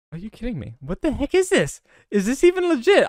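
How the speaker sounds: high-pitched incredulous questions; exasperated disbelief. Real, not acted. Player doubting the game is fair, exasperated.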